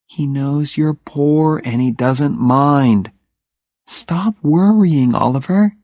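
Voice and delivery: calm him down, slowly; reassuring Jenny. Acted.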